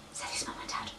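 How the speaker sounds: hushed, breathy narration; hushed whispered aside. A narrator's reading. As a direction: whisper it, scared. Hushed narration after gasp.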